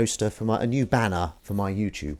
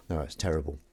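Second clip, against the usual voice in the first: ordinary talk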